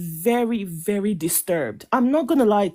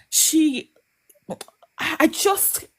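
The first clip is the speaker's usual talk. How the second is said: real emotion, halting and higher-pitched; getting emotional; 8 semitones higher, much slower than usual. Real, not acted. Speaker upset over Shelly's treatment, emotional. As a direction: falter on the words, pitch lifting as feeling takes over. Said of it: "Sorry, I'm getting emotional".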